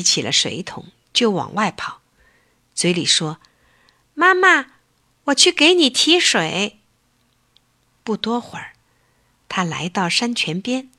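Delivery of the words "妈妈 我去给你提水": put-on high, eager child-bear voice; a small cub calling to his mom; little bear voice. Little bear tells his sick mother he'll fetch water, high eager cub voice.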